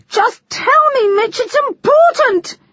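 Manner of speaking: high, loud, near-screaming plea; almost screaming. Acted. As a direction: nearly scream at him. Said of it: "She was almost screaming now".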